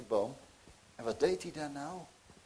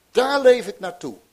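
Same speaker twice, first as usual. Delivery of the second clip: much higher and louder; rising preaching; 10 semitones higher, 13 dB louder than usual. Acted. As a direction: build to the final hope. Closing appeal of expectation. (opzwepend preken, veel hoger en luider)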